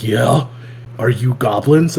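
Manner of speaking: lightly imitated deadpan hero; a touch of stoic monotone; monotone stoic slayer. Goblin Slayer questioning adventurers who need help, understated monotone imitation. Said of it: "monotoned and stoic".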